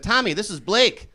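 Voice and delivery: put-on boy's mother. Mother introducing comedian to her son, mock motherly. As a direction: lightly mimic a mom making introductions. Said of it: "She introduced me to her son".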